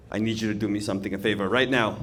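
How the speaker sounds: low, quiet and fast; the governor's hushed, hurried order; Cuomo voice. Retold or imitated. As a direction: rattle off the demand low and soft. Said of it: "He goes".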